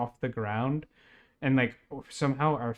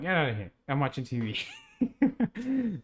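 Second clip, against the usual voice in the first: laughing brush-off; 6 semitones higher, slower than usual. Retold or imitated. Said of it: "I'm like".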